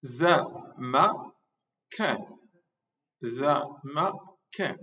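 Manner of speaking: drawn-out Zamakan for the class; slow demo. Shown as a demonstration. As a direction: say Zamakan very slowly, stretching each syllable. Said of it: "qu'est-ce qui nous reste?". (Zamakan très lentement)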